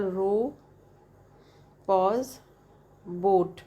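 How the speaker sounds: "row", a stop, then "boat", as two separate parts; split into syllables. Shown as a demonstration. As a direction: break the word in two with a clear pause.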